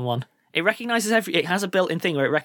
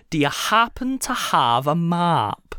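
slow Irish-accent demonstration; drawn-out mock Irish question; much slower than usual. Shown as a demonstration. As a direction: model an Irish accent slowly and deliberately. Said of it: "if you wanna do Irish".

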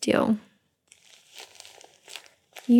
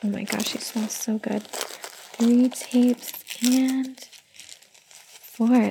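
ordinary talk

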